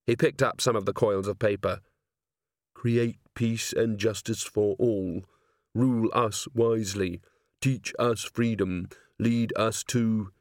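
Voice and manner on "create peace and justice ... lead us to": slow, measured reading; slow, even recital, left unfinished; reading scraps aloud. Acted. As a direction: read each plea slowly and solemnly. Reads words from paper scraps.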